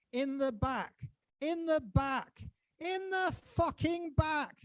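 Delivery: high, loud, slow outraged yell; furious shout. Acted. Poet raging at police shootings, furious shout. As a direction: scream it out, slowing on each word. Climax of protest poem.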